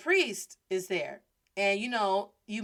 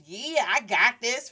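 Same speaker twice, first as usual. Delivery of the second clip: slow, louder self-assured boast; cocky confidence; 2 semitones higher, 5 dB louder, slower than usual. Retold or imitated. Student hyping herself to practice Spanish, confident. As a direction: draw it out, full of confidence. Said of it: "feeling all confident".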